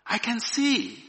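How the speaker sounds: high, loud exclamation; proclaiming. A narrator's reading. Woman discovering sight in the firelight, loud proclamation. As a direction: proclaim it with amazement, pitched high. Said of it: "she proclaimed".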